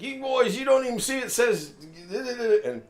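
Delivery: high mock-nagging voice; mocking scolding clerk. Clerk nagging the men who set off the alarm, high mock voice. Employee lecturing the shoppers.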